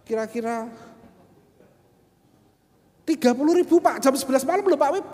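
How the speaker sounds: high, loud insistent fare demand; becak driver haggling. Retold or imitated. Pedicab driver insisting on the night fare, loud and high. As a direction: push the price like a pushy driver.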